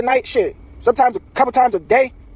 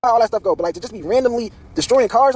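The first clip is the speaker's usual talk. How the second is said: ordinary talk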